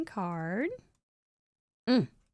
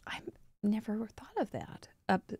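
whisper; 7 dB quieter than usual. Real, not acted. Admiring card detail closely.

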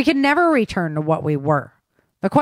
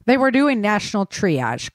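usual speaking voice

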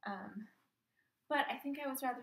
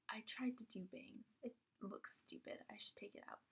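whispering; 12 dB quieter than usual. Real, not acted. Embarrassed aside about bangs.

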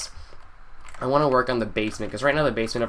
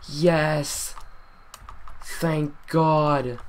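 higher, drawn-out cry of relief; elated relief; 5 semitones higher, much slower than usual. Real, not acted. Gamer who finally got the totem, elated. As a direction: let the triumph out, higher in pitch.

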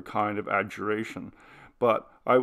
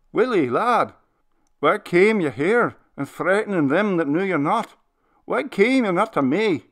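put-on loud, much higher pleading old man with a sob in it; sobbing old minister; 8 semitones higher, 7 dB louder than usual. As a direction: beg the lost lad to come to you, near tears. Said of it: "His sobbing voice".

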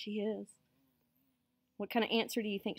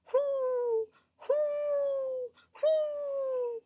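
three long high hoots; high, sustained owl call; much slower than usual. A narrator's reading. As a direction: hoot like a mother owl. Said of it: "and hooted".

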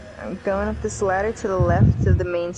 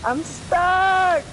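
high, louder panicked yell; 11 semitones higher, 5 dB louder than usual. Real, not acted. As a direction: yell it in real alarm. Still stuck, panicking.

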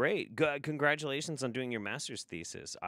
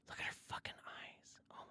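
whisper; 15 dB quieter than usual. Real, not acted. Shocked at a photo on stream.

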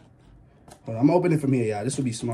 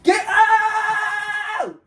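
high, drawn-out panicked yell; panicked scream. Real, not acted. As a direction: shriek at the escaping rat. Rat escaping, screaming.

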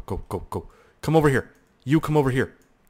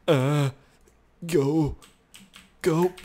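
ordinary talk, nothing marked

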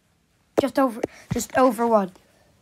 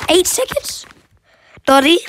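high, loud disbelieving blurt; surprised; 7 semitones higher, 8 dB louder than usual. Real, not acted. Calling to Dottie after a shock result, surprised and loud. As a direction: blurt it out, truly stunned. Stunned by own reaction time.

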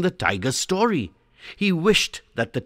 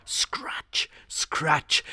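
whispered scratch; 4 semitones higher, 4 dB quieter than usual. A narrator's reading. Tiger claws in nightmare.

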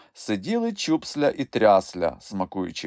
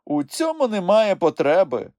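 higher, louder cut-off; sharp, raised-pitch dismissal; sharp retort; 10 semitones higher, 5 dB louder than usual. A narrator's reading. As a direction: shut the question down sharply. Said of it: "гостро відрізав Хропусь".

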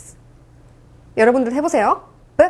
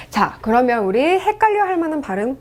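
ordinary talk, nothing marked

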